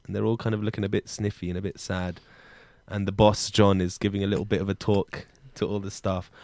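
low, soft narrator voice; scene setting. A narrator's reading. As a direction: set the somber scene.

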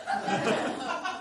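a short real laugh breaking through, rising; can't help laughing at the line; burst of laughter. Real, not acted. Reader laughs at the text.

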